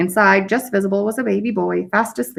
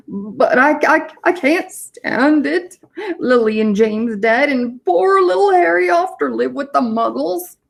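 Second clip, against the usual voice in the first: high, slow, breaking-up lament; a big man falling apart in tears; tearful wail; 6 semitones higher, slower than usual. A narrator's reading. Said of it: "sobbed Hagrid".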